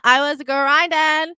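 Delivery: loud, high, drawn-out climax; stretched-out loud declaration; emphatic boast. Acted. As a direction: build to a slow triumphant yell. Boastful climax, first take.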